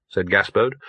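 usual speaking voice

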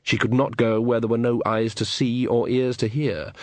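ordinary delivery

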